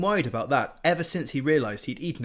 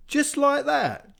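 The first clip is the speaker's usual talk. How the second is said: emphatic; 7 dB louder, much slower than usual. A narrator's reading. Ratcatcher insisting.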